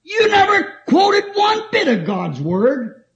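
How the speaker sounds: a lightly voiced old pastor raising his voice to correct the boy; rebuking the young preacher, loud and slow; pastor scolding. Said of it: "He said".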